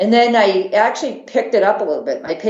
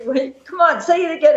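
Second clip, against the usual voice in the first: high-pitched playful coaxing, retold; teasing; 9 semitones higher than usual. Friends goading the runner about the accent, teasing. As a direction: imitate friends egging someone on. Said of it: "kept making fun of my accent".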